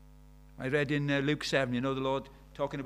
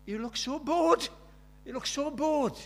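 mock scolding; 10 semitones higher, 5 dB louder than usual. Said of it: "I had to say to the poor dabs".

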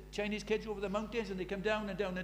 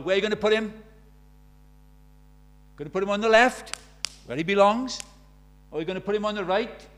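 louder, rising preacher's challenge; preaching at full force; 8 dB louder than usual. Acted. Preacher challenging the congregation about Jesus, loud and fervent. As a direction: press the listeners hard from the pulpit.

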